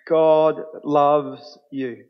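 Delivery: "God loves you" drawn out very slowly, "God" stressed; deliberate, weighty proclamation; slow emphasis. Shown as a demonstration. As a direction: let each word land, unhurried. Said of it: "Just let that flow over you".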